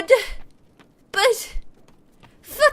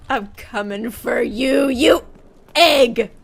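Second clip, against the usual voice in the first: ordinary delivery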